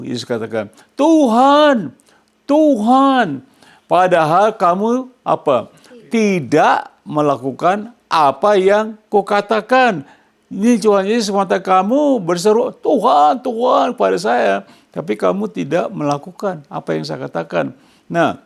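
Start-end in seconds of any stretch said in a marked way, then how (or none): 1.0-10.1 preacher acting Jesus' pained rebuke; voicing Jesus. 11.8-17.7 preacher acting Jesus' pained rebuke; voicing Jesus.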